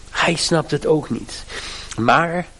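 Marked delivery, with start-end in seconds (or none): none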